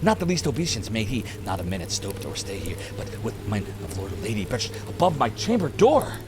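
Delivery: ordinary talk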